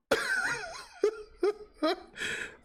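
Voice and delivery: high helpless laugh, near tears; real tearful laughter; laughing fit. Real, not acted. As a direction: laugh uncontrollably in bursts. Cannot stop laughing.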